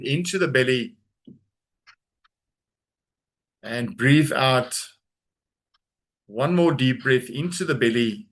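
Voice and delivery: guided breathing. Acted. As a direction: draw out the exhale slowly.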